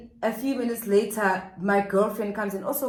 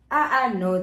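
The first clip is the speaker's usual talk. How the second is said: usual speaking voice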